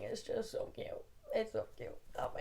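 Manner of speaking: breathy little aside of delight; soft whisper. Real, not acted. Reader gushing to viewers about the sweet ending, whispered.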